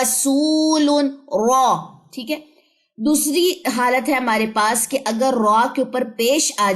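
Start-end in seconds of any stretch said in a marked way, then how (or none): 0.0-1.8 رسول with a thick ra, back of the tongue raised; heavy ra. Shown as a demonstration. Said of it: "آواز کو منہ کے اندر رکھیں".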